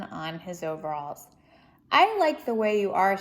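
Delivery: lively, expressive storybook reading; reading to children. Acted. Reading Corduroy aloud to children, lively storybook voice. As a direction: read it out to a young audience with energy. Reads picture book aloud.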